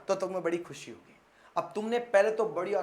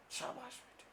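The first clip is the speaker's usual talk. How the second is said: sarcastic whispered praise; a sneering hushed "शाबाश बेटे"; mock praise; 7 semitones higher, 14 dB quieter than usual. Teacher mocking late student. (व्यंग्य भरी फुसफुसाहट)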